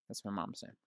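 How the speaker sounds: ordinary talk, nothing marked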